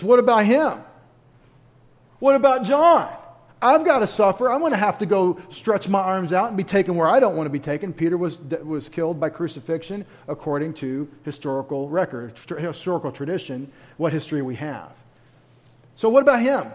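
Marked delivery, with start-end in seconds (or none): 0.0-7.9 loud, high whiny protest; Peter protesting. Retold or imitated. Peter protesting to Jesus about John, loud and high. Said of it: "said to Jesus". 15.9-16.7 loud, high complaining question; Peter whining. Retold or imitated. Peter demanding to know about John, loud and high. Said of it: "Mister Fervent says".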